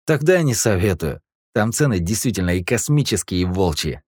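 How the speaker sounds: laughing. Acted. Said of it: "рассмеялся таксист".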